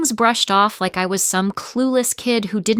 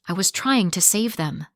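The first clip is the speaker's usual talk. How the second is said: plain reading voice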